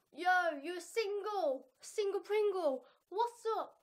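loud, high, drawn-out yo; playful shout, stretching the words; hyped mock greeting. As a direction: call out like a cheesy hype man. Acts out greeting someone single.

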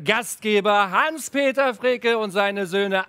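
loud, slow, high announcer voice; grand entrance announcement. Real, not acted. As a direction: announce them like a gala host. Showtreppe-Ankündigung der Gastgeber. (große Gala-Ankündigung)

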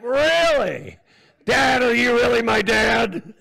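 loud, high-pitched mock disbelief; incredulous child. Child questioning dad, loud mock disbelief. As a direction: cry out like a kid stunned by dad. Acts a child doubting their father.

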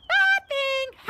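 very high, loud triumphant squeal; gleeful poltergeist. A narrator's reading. Peeves tricking Filch, gleeful high crow. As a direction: crow it in an imp voice.

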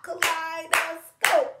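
Kaleidoscope high, loud and slow, syllable by syllable; high, loud chant of Kaleidoscope in even beats; clapped syllables. Shown as a demonstration. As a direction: beat out each syllable of Kaleidoscope.

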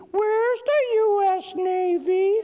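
put-on very high, slow, loud child's shriek; high-piercing toddler voice. Three-year-old interrupting dad's aircraft explanation, high-piercing voice. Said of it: "all of a sudden you hear it repeated".

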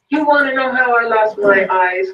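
the blind beggar cries out his story; crying out. A narrator's reading. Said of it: "cried the man".

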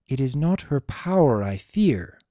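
usual speaking voice

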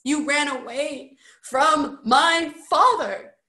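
high, loud, upset reproach; tearful accusation. A narrator's reading. Tearful Roderick blaming Bert for fleeing his father, upset outburst. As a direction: throw the blame at him, close to tears.

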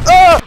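sharp high yelp of fright; startled scream. Real, not acted. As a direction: jump and scream out loud. Sudden fright in VR.

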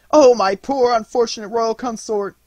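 high, loud, sob-choked lament; a queen wailing to her suffering king; sob-broken cry. Acted. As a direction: wail it through sobs at his feet. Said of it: "cried in a voice broken by sobs".

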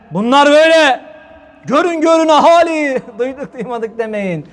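mock town crier. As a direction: announce it like a scandalised crier. Mocking critics denouncing the hoca.

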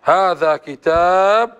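كتاب ending on a silent b, slow; pausal sukun. Shown as a demonstration. Teacher demonstrates stopping with sukun, slowly. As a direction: stop dead on the b of كتاب. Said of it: "بالسكون".

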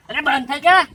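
loud, high snap to stop; exasperated outburst. Acted. Son snapping at old man who won't stop crying, exasperated outburst. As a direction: burst out, at the end of your patience.

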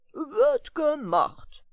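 lightly voiced giant, slow and easygoing; good-natured giant. A narrator's reading. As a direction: take your time, big friendly giant. Giant agreeing.

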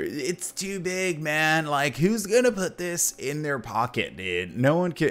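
mock nineties kid scoffing at the Game Gear. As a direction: sneer at the big console. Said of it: "We were making fun of them".

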